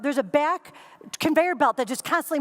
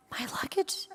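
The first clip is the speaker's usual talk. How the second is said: whispered in confusion, trailing off; hushed, puzzled half-word; bewildered whisper; 4 semitones higher, 8 dB quieter than usual. Retold or imitated. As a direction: breathe it out lost. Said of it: "is this a weird Buddhist moment".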